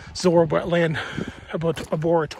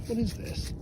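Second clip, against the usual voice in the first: ordinary delivery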